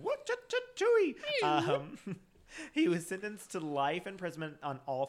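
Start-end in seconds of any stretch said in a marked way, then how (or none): 0.0-1.4 high, silly chopping sound effects; playful woodcutting noises. Pretend. Co-hosts joking about cutting down wood, silly sound effects. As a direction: imitate cutting wood in squeaky bursts.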